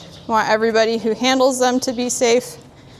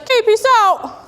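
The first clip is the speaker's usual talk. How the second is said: high put-on horse voice, higher and louder; voicing the horse; 11 semitones higher, 4 dB louder than usual. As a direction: give the horse a goofy high voice. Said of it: "He's like".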